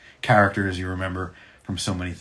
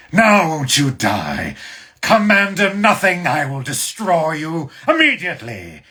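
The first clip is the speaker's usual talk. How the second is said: loud, high, drawn-out mech battle threat; slow, booming threat pitched way up; robot mech villain; 10 semitones higher, 9 dB louder, slower than usual. Shown as a demonstration. Mech pilot threatening an enemy commander, loud robot villain voice. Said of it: "He's more like that".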